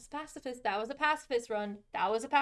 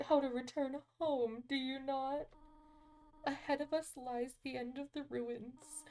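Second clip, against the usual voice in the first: soft, measured character reading; motherly game character; 4 semitones higher, 8 dB quieter than usual. Acted. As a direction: read it gently, like a grave guardian. Reads game character's dialogue aloud.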